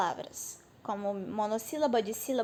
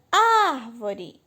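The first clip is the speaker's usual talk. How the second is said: Árvore with ÁR stretched and punched; stress on first syllable; 4 dB louder than usual. Shown as a demonstration. Teacher giving an antepenultimate example, stressed Árvore. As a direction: lean long on the ár of Árvore, then fall away. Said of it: "Só que a mais forte".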